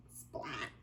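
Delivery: whispered splashy splat; soft unvoiced splat noise; splat sound. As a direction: breathe it out like a splash.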